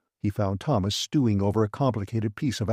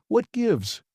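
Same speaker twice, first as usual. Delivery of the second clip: sharp, higher-pitched snap; snapped; 10 semitones higher than usual. Acted. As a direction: bite the question off in irritation. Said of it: "he snapped".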